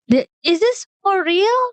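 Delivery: high, amazed whisper-question; breathless after a gasp; stunned. Retold or imitated. (terpana setelah terkesiap)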